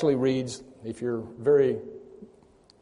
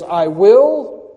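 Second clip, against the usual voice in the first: "will" drawn up high and much louder; emphatic stress on WILL; 6 semitones higher, 13 dB louder than usual. Acted. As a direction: punch WILL as an absolute certainty. Stressing God's certain promise.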